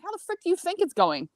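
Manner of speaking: retold exasperated retort, pitched high; exasperated complaint. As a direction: complain indignantly, voice jumping up. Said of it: "And I was complaining".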